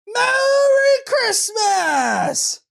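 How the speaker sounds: very high, drawn-out, exultant Merry Christmas; joint triumphant shout. Acted. Shopper and cashier shouting the greeting together in triumph. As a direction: scream it together like a victory cheer. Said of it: "And we both shouted".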